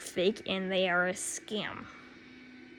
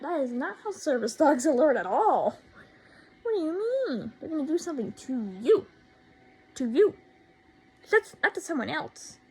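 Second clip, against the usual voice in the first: rising into a loud exasperated rant; 6 semitones higher, 5 dB louder than usual. Real, not acted. Ranting at the classmate about how service dogs alert, louder and exasperated. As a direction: lose patience and push it louder.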